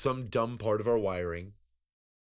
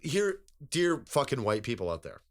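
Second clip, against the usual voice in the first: mock public address; 5 semitones higher, 5 dB louder than usual.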